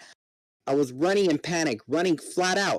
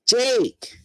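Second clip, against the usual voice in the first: loud, high call across the fight; a friend yells for help in battle; yelling Jake; 10 semitones higher, 5 dB louder than usual. Acted. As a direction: shout his name in alarm. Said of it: "Marco yelled".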